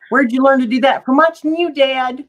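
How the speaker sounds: mock-dramatic parent and child from an ad; old drug commercial. Quoting an old drug commercial at a friend, mock-dramatic. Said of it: "like those old drug commercials".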